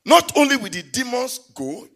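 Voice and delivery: loud, slow, half-sung preaching; high, loud, drawn-out sermon with music; full-force preaching. Acted. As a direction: build to the pulpit climax.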